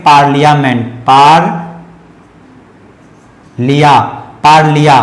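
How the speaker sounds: पार्लियामेंट broken as PAAR, pause, li-yaa; syllable-by-syllable model. Shown as a demonstration. Teacher shows how the word is read, syllable-by-syllable model. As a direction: punch out each part of पार्लियामेंट separately. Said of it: "इसे पढ़ते हैं".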